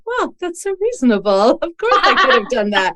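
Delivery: high-pitched, laughing self-quote; amused realization. Retold or imitated. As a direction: exclaim it high, breaking into laughter at yourself. Quotes own reaction, laughing at herself.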